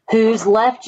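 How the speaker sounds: plain reading voice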